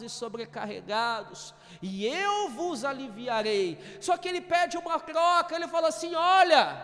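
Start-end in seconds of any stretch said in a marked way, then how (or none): 4.0-6.8 fervent preaching. Real, not acted.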